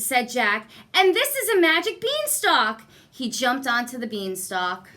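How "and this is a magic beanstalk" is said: put-on excited boy. Jack excited about beanstalk.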